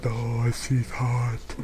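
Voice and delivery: mock dazed admirer. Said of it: "everyone's like".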